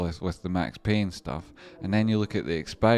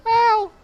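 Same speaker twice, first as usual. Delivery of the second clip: sharp yelp; 11 dB louder than usual. Acted. Sudden yelp.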